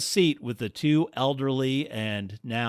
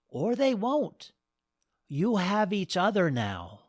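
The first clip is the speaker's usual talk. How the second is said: put-on higher, motherly old woman; warm goddess voice; 9 semitones higher than usual. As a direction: comfort the young couple.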